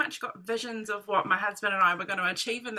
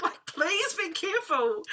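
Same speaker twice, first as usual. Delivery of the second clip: higher, anxious please; pleading warning; 7 semitones higher than usual. Retold or imitated. Anxiously warning the unlicensed buyer, higher and pleading. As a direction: beg him to be careful.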